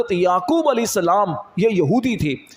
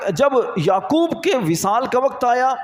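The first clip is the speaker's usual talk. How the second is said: ordinary talk, nothing marked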